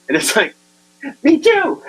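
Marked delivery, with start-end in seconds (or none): 1.2-1.8 surprised reply. Retold or imitated. Punchline reply.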